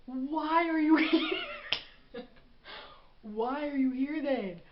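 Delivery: loud, slow, laughing why are you here; laughing disbelief. Real, not acted. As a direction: drag it out through laughter, incredulous.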